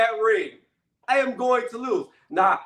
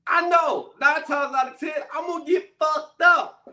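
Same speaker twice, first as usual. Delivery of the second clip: shouting; 7 semitones higher than usual. Real, not acted. Admitting he would lose.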